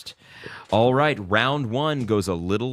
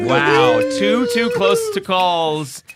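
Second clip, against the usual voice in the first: slow, high, loud exclaiming; excited; 12 semitones higher, 9 dB louder, slower than usual. Real, not acted. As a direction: hype it up like a game host.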